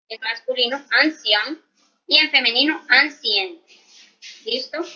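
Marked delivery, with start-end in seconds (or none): 0.9-1.6 "ancien" with a nasal final vowel, no n sounded; "ancien" ending nasal, French masculine. Shown as a demonstration. Teacher models the masculine adjective, nasal ending. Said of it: "la pronunciación acá sí va a cambiar". 2.9-3.6 "ancien" said as ancienne, with a clear n at the end; "ancien" with the n fully sounded; feminine ancienne. Shown as a demonstration. Teacher models the feminine adjective, n sounded. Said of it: "la pronunciación acá sí va a cambiar".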